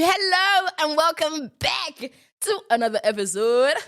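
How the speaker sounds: high, sing-song host greeting; exaggerated presenter hello; show-host welcome. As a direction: welcome them in with a big lifted hello.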